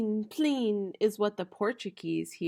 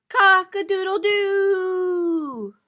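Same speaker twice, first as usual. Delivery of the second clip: high, slow, loud rooster crow; drawn-out rooster cry; 8 semitones higher, 6 dB louder, slower than usual. Shown as a demonstration. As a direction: crow it out big. Reading rooster sound aloud.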